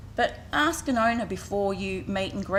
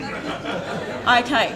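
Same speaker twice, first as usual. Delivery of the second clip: louder, chuckling through the words; laughing; 4 semitones higher, 4 dB louder than usual. Real, not acted. Speaker jokes with the audience about the face exercise, laughing. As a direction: keep a laugh in the voice while talking on.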